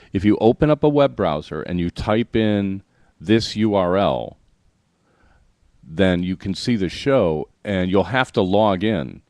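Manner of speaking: slow, step-by-step instructions with deliberate pauses; really slow. Shown as a demonstration. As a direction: spell it out slowly for listeners. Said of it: "I'll say this really slow".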